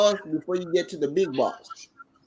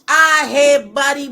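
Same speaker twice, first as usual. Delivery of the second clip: hyped outburst; 8 semitones higher, 10 dB louder than usual. Real, not acted. Excited reaction to harsh bar.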